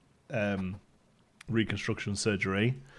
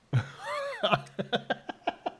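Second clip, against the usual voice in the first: high giggle; 5 dB quieter than usual. Real, not acted. Laughs at chat.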